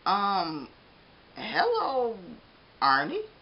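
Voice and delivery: stammering. Acted. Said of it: "Mister Bing stammered".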